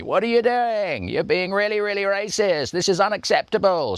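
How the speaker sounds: very high mock screech; hysterical outburst. Producer losing it with the voiceover artist, high hysterical mock outburst. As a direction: mimic her losing it, voice shot way up. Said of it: "totally lost her shit with me".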